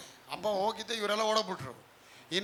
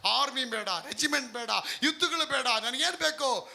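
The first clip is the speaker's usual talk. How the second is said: building preaching; 5 semitones higher, 3 dB louder than usual. Acted.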